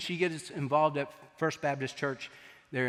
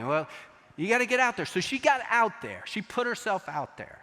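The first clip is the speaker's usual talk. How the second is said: normal talking voice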